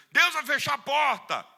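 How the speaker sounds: loud, high, slow pronouncement; hammed-up prophetic declaration; mock preacher proclamation. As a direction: boom it like a bold preacher. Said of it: "Imagine um pregador dizendo".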